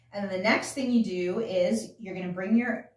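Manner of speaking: usual speaking voice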